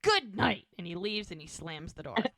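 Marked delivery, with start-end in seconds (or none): 0.0-0.7 louder clipped good night; louder curt parting line; curt angry goodbye. Retold or imitated. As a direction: end it coldly and leave. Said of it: "he's pissed".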